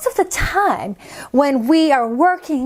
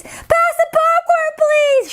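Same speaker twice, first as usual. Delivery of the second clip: put-on much higher child yelling; calling out; 14 semitones higher than usual. Isabella yelling into the phone at Grandma. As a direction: yell it into the phone. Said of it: "loud at movies".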